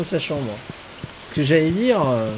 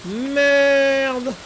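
frustrated curse; 8 semitones higher, 4 dB louder than usual. Real, not acted. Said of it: "frustrated curse".

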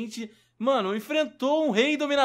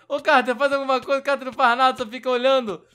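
loud complaint called at Carter; calling out; 4 semitones higher, 6 dB louder than usual. Real, not acted. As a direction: shout across to the idle ally. Calling out to idle NPC.

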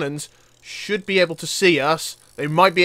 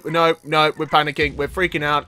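ordinary talk, nothing marked

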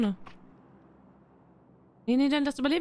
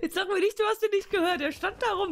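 laughing; 6 semitones higher than usual. Real, not acted. Laughing defence after the kill.

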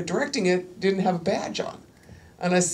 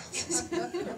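laugh; 13 semitones higher, 11 dB quieter than usual. Real, not acted. Laughs at own performance.

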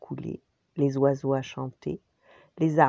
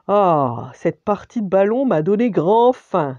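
louder booming giant voice; thundering giant; 4 semitones higher, 9 dB louder than usual. Acted. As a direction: boom it like a hungry giant. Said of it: "d'une voix de tonnerre".

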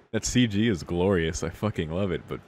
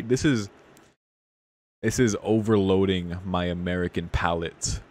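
ordinary talk, nothing marked

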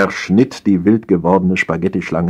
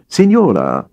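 a lofty, raised Signora; indignant; 5 semitones higher, 4 dB louder than usual. A narrator's reading. Waiter rebuffing the wife, indignant and louder. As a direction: rebuke her with one haughty word. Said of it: "wies der Kellner sie indigniert zurück".

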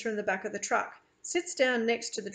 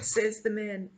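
ordinary delivery, nothing marked

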